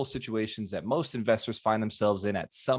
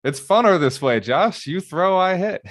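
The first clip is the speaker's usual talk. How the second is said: put-on loud, higher, bossy kid insisting; bossy big brother; 7 semitones higher, 5 dB louder than usual. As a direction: push the little brother around, playfully loud. I was the bigger brother.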